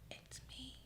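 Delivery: breathy joking whisper; whispered aside. Real, not acted. Friend reading a message, whispered joke.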